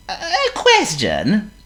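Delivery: very high squeaky worm. Acted.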